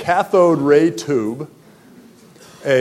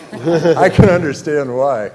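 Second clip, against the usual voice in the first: laughing; 3 semitones higher, 5 dB louder than usual. Real, not acted. Joking about being asked not to sing.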